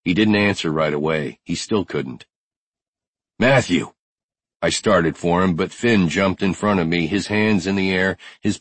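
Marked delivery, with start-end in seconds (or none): 3.4-3.9 raised, urgent call of a name; impatient call. Acted. Brody presses Matthew for an answer, impatient raised call. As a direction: call him to attention impatiently.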